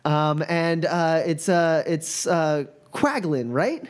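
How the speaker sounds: high, stammering fan voice; flustered student. Acted. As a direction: gush nervously over the famous team. GM voices Mila.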